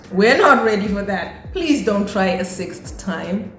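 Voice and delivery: normal talking voice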